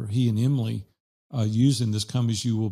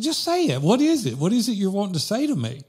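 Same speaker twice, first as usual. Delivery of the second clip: high-pitched, insistent retold plea; impatient urging; 7 semitones higher, faster than usual. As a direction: act out pressing a stalling friend to spit it out. Retells pressing hesitant friend to speak.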